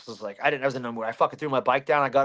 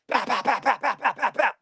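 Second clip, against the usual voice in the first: high, evenly repeated pow sounds; rapid-fire punch noises; 8 semitones higher than usual. Retold or imitated. Retelling how he blitzed the bully, high rapid-fire punches. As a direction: fire off each pow like a quick jab. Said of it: "I was just rapid firing".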